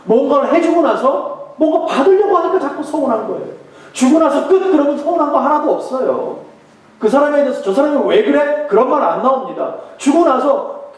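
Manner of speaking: high, loud preaching push; fervent sermon delivery; forceful preaching. Acted. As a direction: press the point home from the pulpit.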